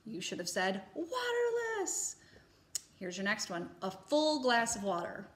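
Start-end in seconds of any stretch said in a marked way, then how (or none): 0.0-2.1 sing-song answer. Shown as a demonstration. Teacher gives answer in high voice.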